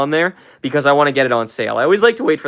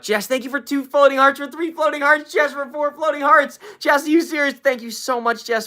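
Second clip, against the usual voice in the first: high, thrilled thank-yous; excited thanks; 12 semitones higher than usual. Real, not acted. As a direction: gush over each gift, voice up high. Thanking for floating hearts.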